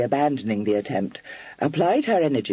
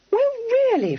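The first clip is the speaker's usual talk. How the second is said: much higher indignant exclamation; indignant cry; 3 dB louder than usual. A narrator's reading. Kitty indignant at Freddy's meddling, a high cry. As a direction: cry out, mildly affronted. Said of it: "cried Miss Charing indignantly".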